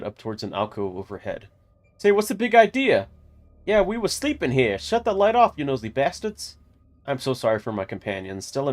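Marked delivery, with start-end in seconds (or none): 2.0-6.6 loud, higher gruff wiseguy bat; old mobster. Acted. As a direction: shout it like an old-time gangster. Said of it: "they sounded like old mobsters".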